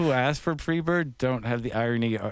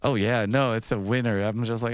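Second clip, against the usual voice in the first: ordinary talk, nothing marked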